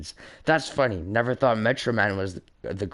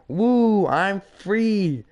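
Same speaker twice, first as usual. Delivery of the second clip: gleeful whoop; 9 semitones higher, 4 dB louder, much slower than usual. Acted. Megamind celebrates his escape.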